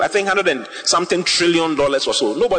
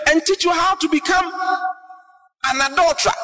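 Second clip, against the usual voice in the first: climbs higher and higher into a shout; rising preacher's cry; 11 semitones higher than usual. Real, not acted. Preacher to the flock, building to a high shouting warning.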